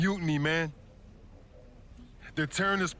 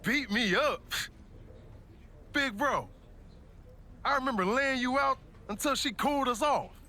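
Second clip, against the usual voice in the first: indignant disbelief; 9 semitones higher than usual. Acted. Brother disputes childhood fight story.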